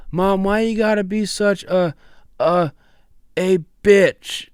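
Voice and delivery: put-on high, loud whiny teen voice; Walter Junior impression. Host imitating Walter Junior's line to his co-host, high whiny teen voice. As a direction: whine it at Mom like a petulant teen. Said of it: "The way he says it, he goes".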